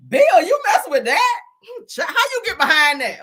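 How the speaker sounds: high, loud, laughing disbelief at Bill; incredulous laughing squeal. Pretend. To Bill about the woman, a laughing high-pitched disbelief. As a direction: shriek it up high like you can't believe it.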